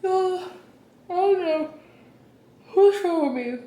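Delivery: high, slow sleepy whine; sleepy whimper after a bad night; groggy. Real, not acted. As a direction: drag it out, half awake and whining. Groggy morning, just woke up.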